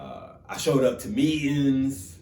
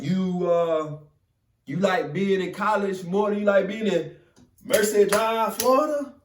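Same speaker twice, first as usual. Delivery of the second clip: mentor teasing; 8 semitones higher, 4 dB louder than usual. Retold or imitated. Mentor questioning him.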